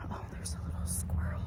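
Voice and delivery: hushed whisper so the squirrel stays put; breathy hush on spotting a squirrel; whispering to the viewers about a squirrel nearby. Real, not acted. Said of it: "I don't know why I'm whispering".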